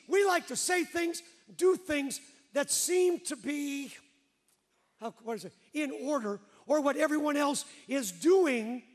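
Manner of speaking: preaching. Acted.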